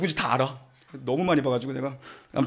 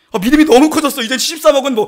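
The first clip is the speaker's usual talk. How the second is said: mock boast; 6 semitones higher, 4 dB louder than usual. Real, not acted. Boasting big faith jokingly.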